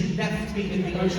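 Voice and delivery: normal talking voice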